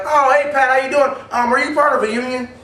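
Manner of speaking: high, loud, eager phone greeting; cheery phone voice. Shown as a demonstration. Salesman greeting Pat on the phone, cheery. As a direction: sound thrilled he called. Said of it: "I'm like".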